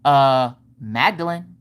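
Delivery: slow, low sassy answer; super sassy. Retold or imitated. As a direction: draw the name out, full of attitude. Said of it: "She's super sassy".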